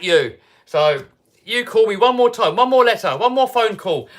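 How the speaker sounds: defiant threat. Retold or imitated. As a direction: lay down the law to the caller. Threatening the debt collector.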